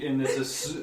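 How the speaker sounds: ordinary talk, nothing marked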